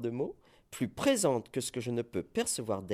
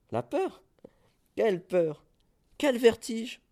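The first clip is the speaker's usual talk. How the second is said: much higher, loud defiant questions; defiant exclamations; 10 semitones higher, 6 dB louder than usual. Acted. Tightrope walker defying fear, high and loud. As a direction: toss away the fear with bravado.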